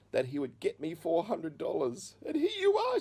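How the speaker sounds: imitated overwhelmed cry, rising very high; mother nearing tears. Mother tells son her prayer was answered, acted near tears. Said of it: "Then she burst into tears again".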